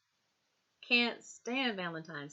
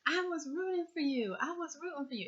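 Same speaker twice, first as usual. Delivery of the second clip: much higher, dramatic imitation of the iconic line; Tyra Banks impression; 9 semitones higher than usual. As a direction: do the famous host's line, pitched way up. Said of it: "like how when Tyra Banks said it".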